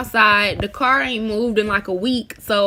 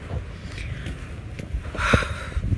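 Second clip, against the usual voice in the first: heavy, drawn-out sigh of defeat; a long fed-up sigh; long exasperated sigh; 8 dB quieter than usual. Real, not acted. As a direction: let all the air out in frustration. Car keys forgotten, exasperated.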